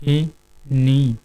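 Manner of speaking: Mohini stretched very slowly and softly; drawn-out word. Shown as a demonstration. Teacher stretching a name to show its ending, very slow. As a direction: draw out Mohini to hear the last sound. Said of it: "केवल हम अंत वाला ही खोल रहे हैं".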